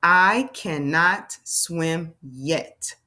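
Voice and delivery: very slow, word by word; slow choral reading. Shown as a demonstration. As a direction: read each word slowly with the class. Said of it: "Let's read that sentence together".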